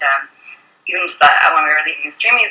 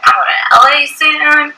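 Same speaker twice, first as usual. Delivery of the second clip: giddy brag; 9 semitones higher, 3 dB louder, slower than usual. Retold or imitated. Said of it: "I was like".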